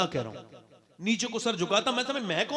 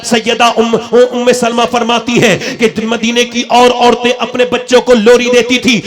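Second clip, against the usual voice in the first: loud, rhythmic sermon cadence; driving preaching; 14 dB louder than usual. Acted. Preacher contrasting mothers of Medina with today's, loud and rhythmic. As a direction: hammer out each repeated phrase, building louder.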